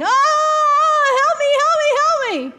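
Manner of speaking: high, loud, panicked screams for help; terrified scream. Acted. Man in gorilla suit screams at stalking lion, high loud panic. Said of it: "started screaming".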